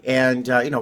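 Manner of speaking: ordinary talk, nothing marked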